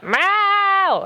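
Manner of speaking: long, very high screaming meow; drawn-out insistent cat yowl; imitated long meow. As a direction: stretch the meow out high and demanding. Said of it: "scream for longer and louder".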